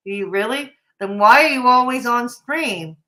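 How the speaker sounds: normal talking voice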